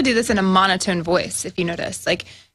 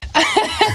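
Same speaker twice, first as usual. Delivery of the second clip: laughing; 13 semitones higher than usual. Real, not acted.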